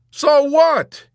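loud, high-pitched retort; exasperated. A narrator's reading. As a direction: shout it back in exasperation. Yossarian's exasperated retort to Dobbs.

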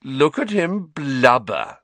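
slow, sneering, jeering bully; Malfoy jeering at a crying teacher; sneering mockery. A narrator's reading. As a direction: drag out the taunt. Malfoy mocking Hagrid.

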